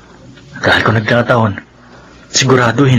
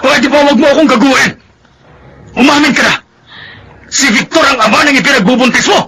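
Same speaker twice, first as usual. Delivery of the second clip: furious accusation. Acted. Jealous husband yelling at his wife with a gun. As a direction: hurl it at her like an accusation.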